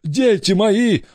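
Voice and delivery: high, drawn-out cry; convinced exclamation. A narrator's reading. Pirate now believes they are his children, high exclamation. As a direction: cry out to long-lost children, won over. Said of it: "воскликнул уверовавший Хайнд".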